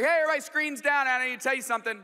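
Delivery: put-on loud, fast call to the kids; dad calling screens down in the van. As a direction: snap them off their screens.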